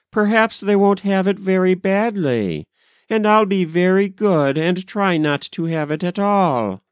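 put-on high-pitched little girl, reassuring; earnest child. A narrator's reading. Molly comforting mother about the measles, earnest. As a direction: promise like a well-meaning child.

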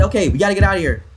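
rushed urgent talk; hurry, escaping; being chased. Real, not acted. Escaping fast.

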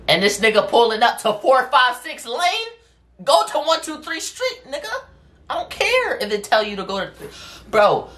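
high-pitched exasperated rant; worked-up, incredulous high voice; exasperated outburst. Retold or imitated. Exasperated rant at driver in story.

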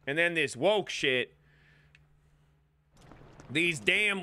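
put-on old-timer grumbling in a country accent; hammed-up country accent. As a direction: reminisce like a country-accented codger. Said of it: "in this accent".

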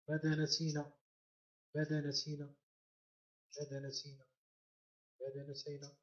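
from normal voice down to barely audible; fading echo. Pretend. Repeats question like a dying echo.

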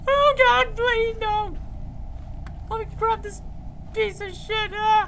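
shrill screaming pleas; high panicked pleading. Real, not acted. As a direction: beg in terror.

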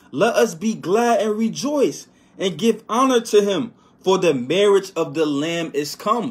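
exultant pulpit reading; preaching scripture. Acted. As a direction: stretch each word out in praise. Reads scripture in a proclaiming voice.

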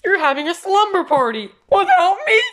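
high, loud indignant question; loud high-pitched protest; hurt outburst. Acted. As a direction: cry out at being left out. Left out of a party.

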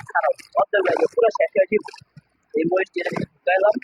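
high, strained from the chili burn; excited. Real, not acted. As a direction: wound up by the burning chilies. Chili burn after eating. (ঝালে উত্তেজিত)

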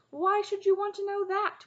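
loud, very high-pitched questioning; incredulous cry. A narrator's reading. Cole answering Taryn's sword wish, incredulous cry. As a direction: ask in loud, high disbelief. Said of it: "cried Cole".

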